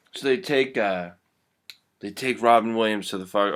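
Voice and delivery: normal talking voice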